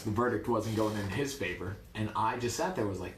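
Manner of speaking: ordinary delivery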